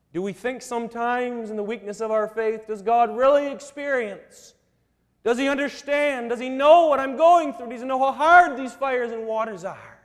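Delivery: a preacher's mounting appeal; building preaching. Acted. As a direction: pile the questions up, pressing harder. Rising string of questions.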